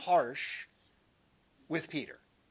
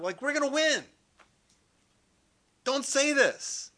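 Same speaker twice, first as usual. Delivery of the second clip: higher, louder, urgent Peter rebuking his master; a disciple rallying his teacher, higher and louder; Peter pleading; 6 semitones higher, 5 dB louder than usual. Acted. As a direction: push back hard, eager and insistent. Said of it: "Takes him aside".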